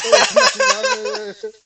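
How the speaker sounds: bursting into loud, slow laughter mid-word; high, loud helpless laugh; laughing hard. Real, not acted. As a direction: crack up at your own story. Laughing at wet boots.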